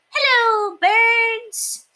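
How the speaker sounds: cheerful lanky mouse; tall mouse. A narrator's reading. Very Tall Mouse greeting friend, high and slow.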